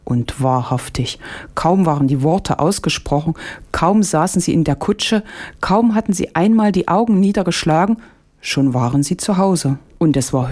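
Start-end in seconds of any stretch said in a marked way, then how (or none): none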